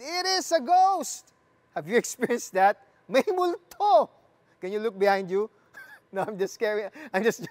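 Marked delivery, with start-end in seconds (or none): 0.0-1.3 high, imitated scared cry; mock scream. Preacher retelling the disciples' fear, mock scream. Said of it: "so fearful that they screamed". 3.1-4.1 pretend fright. Acting scared of a ghost.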